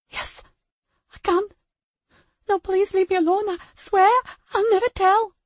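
put-on high, loud, pleading little girl; terrified child voice. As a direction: beg through fear. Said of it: "The tears were falling".

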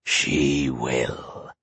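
low, quiet gloating monster; deep monster voice. Acted. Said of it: "The monster cackled with glee".

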